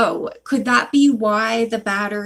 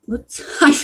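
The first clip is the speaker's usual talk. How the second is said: usual speaking voice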